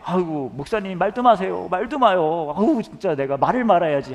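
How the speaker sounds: complaining church wife. Retold or imitated. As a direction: ham up a fed-up wife waving off the pastor. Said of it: "이런 말씀을 주로 하세요".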